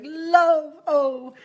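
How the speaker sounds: loud, high lunging cry of love; throwing yourself at love. Retold or imitated. Reader mimics client flinging herself at love, loud high cry. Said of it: "I just see you throwing yourself".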